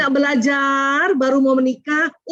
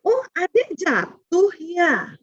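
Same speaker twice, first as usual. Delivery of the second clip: gentle validating; 6 semitones higher, much slower than usual. Shown as a demonstration. Parent soothes a child pointing at its hurt leg, slowly. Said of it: "Itu latihan aja".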